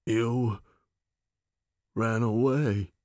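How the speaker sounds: put-on a touch of a small child, hurt; childlike voice. Big Cindy to Myron, lightly childlike and hurt. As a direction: speak it like a lost little girl. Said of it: "Big Cindy's voice was childlike".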